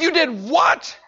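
high, loud disbelief; can't believe what he heard; shocked disbelief. Retold or imitated. Patient reacting in shock to the neighbor.

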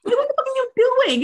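exasperated outburst. Real, not acted. Ranting at men.

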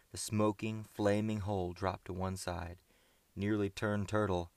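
plain reading voice

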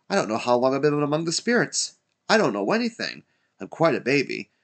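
quick, high-pitched babble; giddy excitement. A narrator's reading. Scrooge fresh from the spirits, giddy excitement. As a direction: tumble through it in happy confusion.

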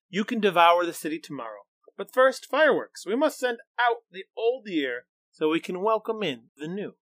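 put-on high excited fast girl voice. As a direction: lure him with eager glee. Girl's excited voice.

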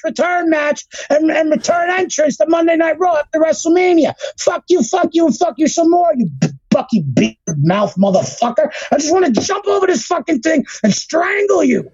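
high-pitched venting at a hated producer; furious rant. Real, not acted. Ranting straight at Kevin Dunn about Cody's entrance, furious and high. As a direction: tear into him, really worked up.

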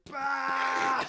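high, burst-out yelp of joy; excited yelp. Retold or imitated. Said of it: "we would literally just be like".

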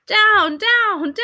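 high, loud repeated command; shouted command. A narrator's reading. Gentleman fends off a barking dog, alarmed shout. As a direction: shout it at the dog, each word falling.

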